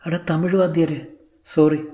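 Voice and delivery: understated smile through tears, high voice; high voice, a touch tearful and amused; Sulekha. A narrator's reading. Crying actress greeting her Tamil teacher, mildly smiling through tears. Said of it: "கண்ணீரிடையே சிரிப்பது".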